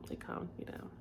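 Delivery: soft, voiceless whisper; barely audible whispered repeat. Real, not acted. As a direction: whisper it as a quiet echo to yourself.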